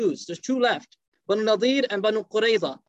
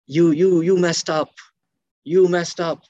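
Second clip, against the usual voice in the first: louder reproach, you messed up; scolding; 5 semitones lower, 4 dB louder, slower than usual. Retold or imitated. Tribesmen rebuke their leader after the failed plot, louder scolding.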